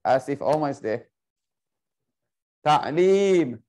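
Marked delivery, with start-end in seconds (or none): 2.6-3.6 louder, with a long held last syllable; drawn-out call. Shown as a demonstration. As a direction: call the word out, stretching the vowel. Calling out the word being searched.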